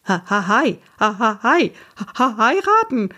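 stuttered, gasped-out attempts at one word; gasping. A narrator's reading. Papa shocked by his mother's wedding news, gasping. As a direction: choke on it, rising with each try. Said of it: "keuchte Papa".

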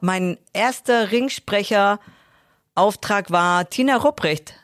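ordinary talk

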